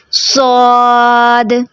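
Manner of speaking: सौद heavy at the start and light at the end; wrong fading saad. Shown as a demonstration. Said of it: "तो ये भी गलत हो जाता है".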